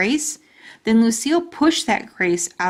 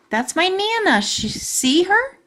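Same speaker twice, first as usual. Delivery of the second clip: put-on proud little girl; 10 semitones higher than usual. Lucille proudly shows the locket.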